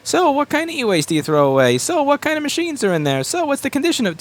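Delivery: a picky pickup company sizing you up in a high mock voice; Tinder-style screening. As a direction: sweetly quiz them like a first date. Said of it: "they'll act like Tinder".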